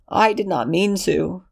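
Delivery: high, small frightened plea; cowering Lexington. Acted. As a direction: shrink into a high whimpering excuse.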